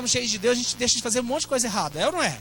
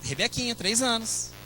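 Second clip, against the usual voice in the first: ordinary delivery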